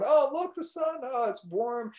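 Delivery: high, delighted voices greeting the sun; delighted villagers. Acted. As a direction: gush up high like happy villagers.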